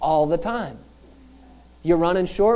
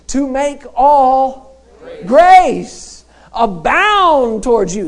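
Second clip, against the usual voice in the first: loud, high preaching voice; full-force preaching; 7 semitones higher, 8 dB louder than usual. Acted. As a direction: preach it out to the whole church.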